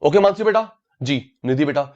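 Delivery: ordinary delivery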